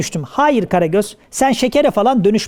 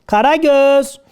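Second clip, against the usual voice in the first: higher-pitched name call; wake-up call; 4 semitones higher, much slower than usual. Acted. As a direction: call out to rouse the snorer. Horlayanı yine uyandırmaya çalışıyor. (uyandırmak için seslenme)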